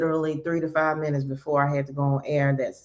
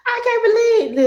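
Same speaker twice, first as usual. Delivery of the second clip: mock amazed listener; 6 dB louder than usual. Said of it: "you'll be like".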